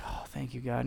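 hushed relieved thanks; relieved quiet prayer. Retold or imitated. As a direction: murmur thanks to God, relieved. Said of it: "I'm like".

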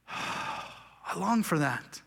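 soft, slow, longing sigh. Real, not acted. Pastor yearning for rest, sighing softly. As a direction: breathe it out with longing.

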